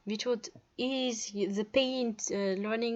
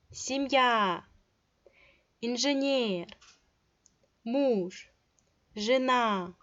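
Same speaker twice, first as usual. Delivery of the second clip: семья, инженер, муж, жена with long stressed vowels; teacher drawing out stress in семья, инженер, муж, жена; lengthened stressed vowels; slower than usual. Shown as a demonstration. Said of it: "and it is lengthened".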